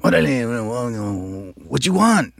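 drawn-out, high mock gang-member voice; put-on gangster, slow then rising high. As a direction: stretch it out like an auditioning gangster. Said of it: "the gang member".

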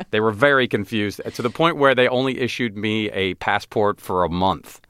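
normal talking voice

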